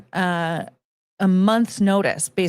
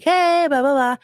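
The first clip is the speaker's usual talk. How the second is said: breezy mock greeting; 7 semitones higher, 3 dB louder than usual. Acting out popping in to visit.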